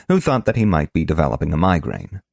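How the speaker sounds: ordinary delivery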